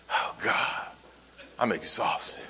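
very soft, low, breath-heavy weariness; exhausted. Acted. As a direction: sigh it out, worn out from the day. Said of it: "you know that tone right there".

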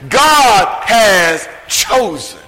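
slow, ringing "God has chosen"; slow proclaiming stress on "God"; proclaimed emphasis. Shown as a demonstration. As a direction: call out "God has chosen" slowly, hitting "God". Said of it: "That's the emphasis".

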